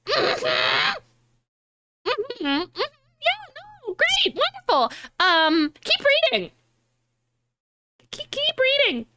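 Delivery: very high, sing-song knowing hums; knowing smug. Retold or imitated. Knowing friend humoring a new reader, very high and sing-song. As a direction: hold back the spoilers with a smug sing-song. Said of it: "And I'm sitting there like".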